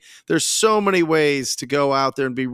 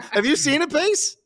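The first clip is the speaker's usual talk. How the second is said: laughing; 10 semitones higher than usual. Real, not acted. Asks while still laughing.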